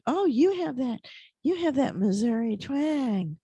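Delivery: high, slow teasing voice; mocking Californians. Californians teasing the new kid about his twang, in a high mocking voice. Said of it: "a number of people there said".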